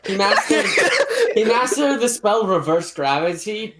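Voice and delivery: laughing through words; giggling while telling it. Real, not acted.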